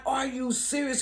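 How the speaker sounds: high, disbelieving question; can't believe what she's hearing; incredulous. Retold or imitated. To the driver on the phone, high incredulous. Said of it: "And I'm like".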